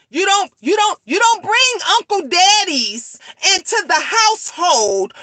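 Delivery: high, loud ranting shout; furious shout. Real, not acted. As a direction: yell it at the whole room, fired up. Heated rant about real mothers.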